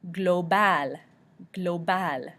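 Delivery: global as gloh-BAL, French style; French pronunciation. Shown as a demonstration. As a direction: say global with a clear A in the last syllable. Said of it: "L is also pronounced at the end".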